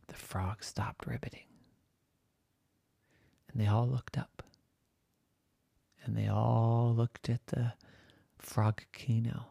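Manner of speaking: soft suspense whisper; storyteller whispers the frogs going still; whispered hush. A narrator's reading. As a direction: drop to a whisper, something's coming. Sudden hush in bedtime story.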